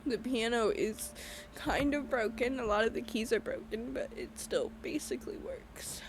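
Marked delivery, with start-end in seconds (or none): 3.9-6.0 softer, tearful apology; words dissolving into tears; breaking down crying. Real, not acted. Apologising to viewers as she breaks down crying. Breaks down over grandma's piano.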